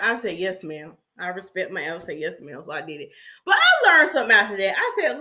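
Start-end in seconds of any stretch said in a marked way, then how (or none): 0.4-1.0 low, soft, subdued reply; small, obedient voice, dropped low; meek compliance. Retold or imitated. Repeats her obedient reply to elder. 2.2-2.6 low, soft, subdued reply; small, obedient voice, dropped low; meek compliance. Retold or imitated. Quotes her respectful reply to elder.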